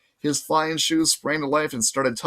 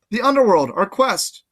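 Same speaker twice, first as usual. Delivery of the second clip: urgent; 8 semitones higher, 6 dB louder than usual. Acted. Urging about the quest.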